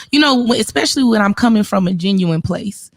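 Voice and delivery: ordinary talk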